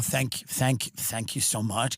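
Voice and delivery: soft, low gushing thanks; desperate grateful murmur. Retold or imitated. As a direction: thank them meekly, desperate for work. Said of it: "You go".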